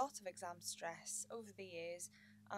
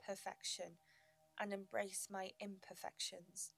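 guided meditation. Acted. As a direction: guide the listener gently into calm.

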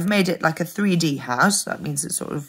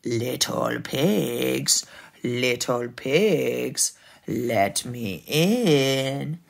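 low, very slow wolf call; sly, lingering villain voice; big bad wolf; 5 semitones lower, much slower than usual. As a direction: draw it out low and menacing. Wolf calls to pigs.

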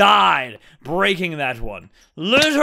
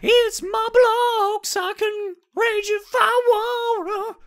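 very high, slow bratty wail; squealing childish voice mocking a rage quit; mock tantrum; slower than usual. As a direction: play a spoiled child. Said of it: "echoes the tantrum".

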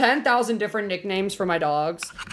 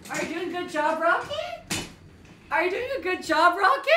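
dog voice; 9 semitones higher than usual. Real, not acted. Streamer checking on Rocket's job, high dog voice. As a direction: pitch it way up, rising on the name. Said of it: "Now I'm using my dog voice".

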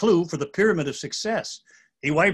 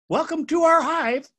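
high, bright bee voice pitched way up; a welcoming bee greeting the travelers, much higher; friendly buzzing bee; 10 semitones higher than usual. Acted. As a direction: sing it out like a cheerful little bee. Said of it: "the friendly bee buzzes".

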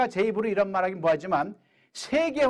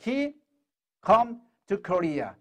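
very slow, word by word; slow demo; much slower than usual. Shown as a demonstration. Teacher reading slowly to students, deliberate. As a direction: spell out each word slowly for learners. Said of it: "하고 이제 자유스럽게 말하면 돼요".

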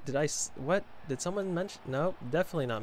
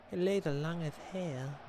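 put-on voice; 3 semitones higher than usual. Streamer echoing the remark about long legs, put-on voice. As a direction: repeat it in a silly put-on voice.

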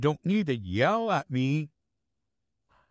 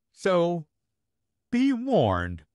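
warning; 6 semitones higher, 3 dB louder, slower than usual. Acted. Vampire warns of bigger Howdy.

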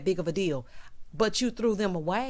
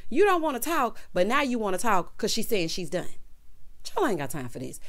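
ordinary delivery

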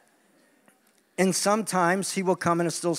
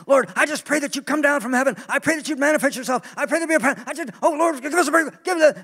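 mock desperate prayer; 8 semitones higher than usual. Preacher parodying worshippers begging God for breakthrough. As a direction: climb into a high, frantic plea. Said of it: "come into a worship meeting and say".

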